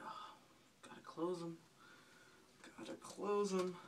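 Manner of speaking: soft hushed words to himself; hushed self-talk. Retold or imitated. Soap nearly in his eyes, hushed self-talk. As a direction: say it softly to yourself.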